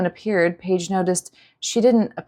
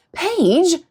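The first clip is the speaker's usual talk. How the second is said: high alarmed shout; 10 semitones higher, 4 dB louder than usual. A narrator's reading. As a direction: yell her name in alarm. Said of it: "Dewey shouted".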